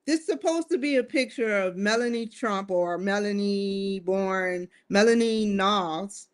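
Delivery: ordinary delivery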